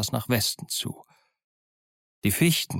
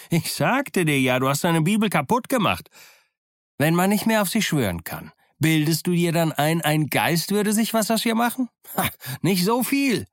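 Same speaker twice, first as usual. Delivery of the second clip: mocking taunt; 7 semitones higher than usual. Silver mocks frightened Dick and his Bible, high jeering. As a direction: sneer at his fear, climbing higher. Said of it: "Silver verspottete ihn".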